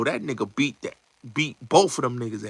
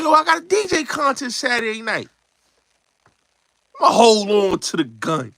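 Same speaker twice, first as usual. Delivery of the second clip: pitched way up, cocky; high character quote; 8 semitones higher than usual. Retold or imitated. Retelling [name] keeping the gun, high cocky quote.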